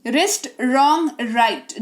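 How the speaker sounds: रिस्ट रॉन्ग राइट said slowly with no W sound; रिस्ट रॉन्ग राइट, W left silent, each word drawn out. Shown as a demonstration. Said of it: "डब्ल्यू का उच्चारण नहीं किया जाएगा". (W हटाकर धीरे-धीरे रिस्ट रॉन्ग राइट)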